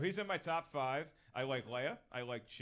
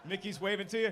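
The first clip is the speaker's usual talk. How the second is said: usual speaking voice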